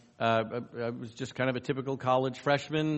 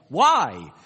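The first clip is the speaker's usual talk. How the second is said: blurted question; 3 semitones higher, 9 dB louder than usual. Retold or imitated. Interviewer presses the student.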